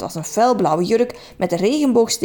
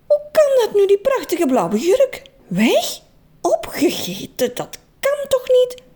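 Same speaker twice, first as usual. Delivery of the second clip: high, crying disbelief; dismayed wail; 11 semitones higher, slower than usual. Acted. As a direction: wail in shock at the lost dress. Trudy shocked her dress is gone. (Trudy jammert hoog en huilerig om haar opgegeten jurk)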